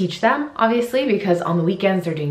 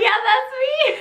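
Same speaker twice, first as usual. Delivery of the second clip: laughing reply. Retold or imitated. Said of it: "And I was like".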